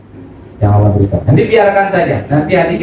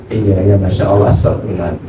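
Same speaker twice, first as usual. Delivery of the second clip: retold low remorseful murmur; 10 semitones lower than usual. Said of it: "beliau mengatakan".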